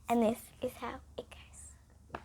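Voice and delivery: slow hushed whisper to begin; mysterious whisper. Acted. Storyteller hushing the kids before the tale, whispered. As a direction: draw the kids in secretly.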